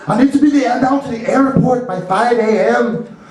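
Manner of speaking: high, lilting Irish caller; Irish accent. Acted. Caller needing an airport ride, Irish accent. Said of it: "he has this Irish accent".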